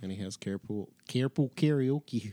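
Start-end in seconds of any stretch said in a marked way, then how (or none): 1.1-2.3 slow, drawn-out Chicago accent. Shown as a demonstration. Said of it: "say that in a Chicago accent".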